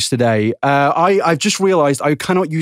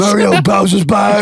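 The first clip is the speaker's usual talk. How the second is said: slow, higher, shouted Toad line; imitating Toad again; 6 semitones higher, 4 dB louder, slower than usual. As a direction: yell it like the little mushroom man. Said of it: "He sounds like Roma from Noobs".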